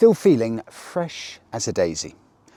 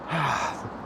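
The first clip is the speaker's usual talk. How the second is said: long weary sigh, breath fading out; resigned sigh; 4 semitones higher, 9 dB quieter than usual. Real, not acted. Vlogger sighing about car-park thieves, a weary sigh. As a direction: let out a tired sigh at the world.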